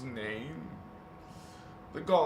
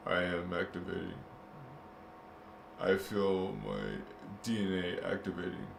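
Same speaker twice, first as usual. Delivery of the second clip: mock trance; 7 semitones lower, 4 dB quieter, faster than usual.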